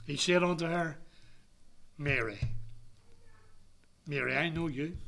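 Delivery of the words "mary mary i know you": soft, low, unhurried address; hushed voice of someone who knows the listener well; tender recognition. Acted. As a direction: call the name gently, with quiet assurance. Acts the Lord recognizing Mary.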